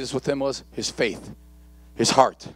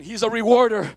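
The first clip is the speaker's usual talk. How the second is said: normal talking voice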